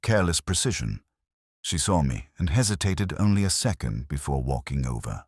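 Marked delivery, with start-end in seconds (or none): none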